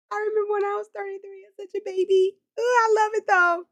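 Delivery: high-pitched fond gush; delighted squeal. Real, not acted. Reacting to viewer's age.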